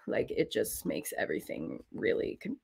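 low, creaky fry voice; demonstrating vocal fry; vocal fry demo. Shown as a demonstration. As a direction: drag the words down into creaky fry. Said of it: "vocal fry".

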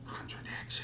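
a hushed, voiceless aside; whisper. Acted. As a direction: whisper it like a secret to the class. Aside after pointing out hypocrisy.

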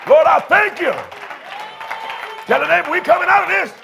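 high, shouted church preaching; full-force preaching. Real, not acted. Pastor rallies weary congregation to praise, full-force preaching.

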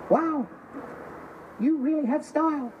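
high, drawn-out tiny compliment; another little voice. Acted. Bowl of nuts flattering a drinker, high little voice. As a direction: pipe up slowly from the bowl. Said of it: "there's another voice going".

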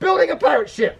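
high-pitched disbelief, echoed back; incredulous dad. Acted. Dad questions the pirate ship, high and incredulous.

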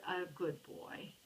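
plain reading voice

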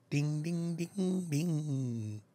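soft mock bell.

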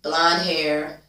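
normal talking voice

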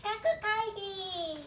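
slow, drawn-out title call; announcer voice. Acted.

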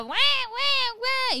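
high mock newborn cry; imitated baby wail. Newborn crying in the delivery room, high mock wail.

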